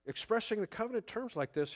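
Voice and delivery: ordinary talk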